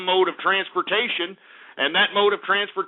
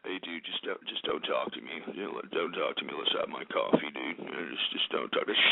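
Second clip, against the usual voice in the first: much lower, much softer groggy mumble, fading to a whisper; mock grumpy coffee addict; 10 dB quieter than usual. Ranting host mimics people who need coffee, in a low groggy mumble. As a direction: drag the words out like a half-awake grump begging to be left alone.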